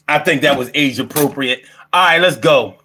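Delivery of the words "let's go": ordinary talk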